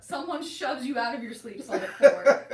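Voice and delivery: loud, laughing through each line; giggling while she teases. Real, not acted. Teasing her cohost about sleep slots, laughing.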